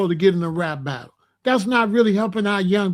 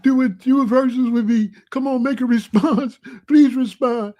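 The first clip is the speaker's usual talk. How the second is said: mock begging; 3 semitones higher than usual. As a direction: beg like a pathetic fan, hammed-up. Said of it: "He need to stop begging fifty".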